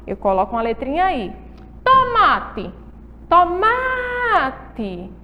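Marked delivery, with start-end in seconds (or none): 1.8-2.7 "Tomate" with MA pitched high; teacher's model "Tomate", stress on MA; stressed middle syllable. Shown as a demonstration. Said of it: "a sílaba tônica é o ma". 3.3-5.1 "Tomate" with MA held long and high; exaggerated long MA in "Tomate"; stressed syllable drawn out. Shown as a demonstration. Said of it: "a sílaba tônica é o ma".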